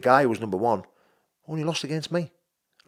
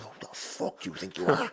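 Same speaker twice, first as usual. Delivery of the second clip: muttered inner thought; 4 semitones higher, 9 dB quieter than usual. Retold or imitated. Voicing his own angry thought.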